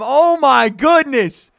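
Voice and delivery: breathless live yelling; excited shouting. Real, not acted. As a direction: yell every punch as he wobbles. Second knockdown called live.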